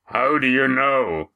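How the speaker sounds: booming sailor's challenge; Roaring Jack. A narrator's reading. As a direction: roar the question slowly, without real shouting. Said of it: "The last and loudest was from Roaring Jack".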